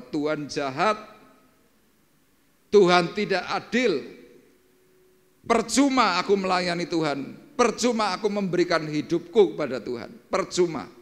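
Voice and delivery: high, loud, anguished accusation; hysterical screaming. Acted. Grieving widow screaming at God, high and loud. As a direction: hurl it at God in grief. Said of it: "Dalam teriakan kehisterisan itu dia berkata".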